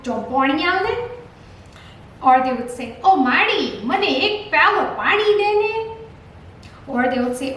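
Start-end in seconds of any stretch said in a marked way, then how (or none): 0.1-0.9 rustic village Gujarati, rising at the end; Gujarati village dialect. Shown as a demonstration. Teacher showing how villagers ask for water, rustic Gujarati dialect. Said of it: "people from the villages would say that". 3.1-5.7 high, loud regional Gujarati plea; regional Gujarati dialect. Shown as a demonstration. Child asking Mom for water, high-pitched regional Gujarati. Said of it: "same sentence will be pronounced very differently".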